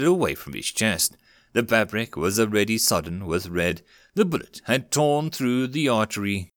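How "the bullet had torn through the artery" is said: plain reading voice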